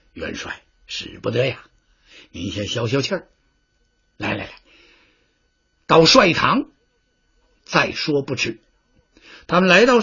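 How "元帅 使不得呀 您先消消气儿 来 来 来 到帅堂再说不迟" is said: much softer, low coaxing plea; calm the angry general down gently; soothing advisor. Acted. Advisor Chen Xi soothes the furious general, soft and coaxing.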